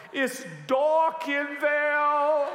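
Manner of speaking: put-on high, slow, sing-song child's whine; small son peering into the dark garage, whining; scared little boy. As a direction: stretch out dark like a frightened toddler. Scared son's voice, sung high.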